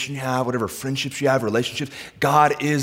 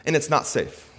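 high, driving sermon delivery; fervent preacher in full flow; impassioned preaching; 9 semitones higher, 5 dB louder than usual. Acted. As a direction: pile up urgent questions to God.

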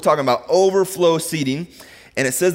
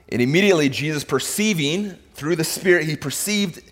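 normal talking voice

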